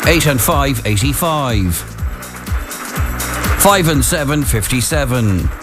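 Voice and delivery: drawn-out bingo number calling; bingo caller. Acted. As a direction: call each number with a pause.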